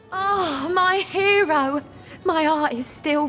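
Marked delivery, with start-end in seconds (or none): 0.1-1.8 high, drawn-out swoon; adoring gush. Acted. Rescued woman greeting her hero, gushing. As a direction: stretch it out with grateful adoration.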